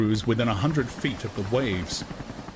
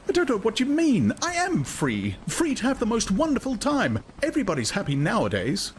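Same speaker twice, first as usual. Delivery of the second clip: high, bright insistent woman's voice; a woman defends her happiness; Lenina insisting. A narrator's reading. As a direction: recite her contentment firmly. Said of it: "Lenina insisting".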